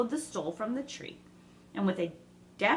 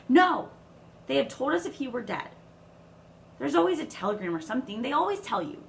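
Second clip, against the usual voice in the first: upset outburst; 6 semitones higher, 6 dB louder than usual. A narrator's reading. Meg frantic about her father.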